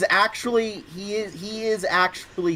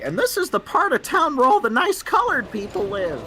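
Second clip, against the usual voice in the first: grandma impression; 8 semitones higher than usual. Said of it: "when your grandma says something like".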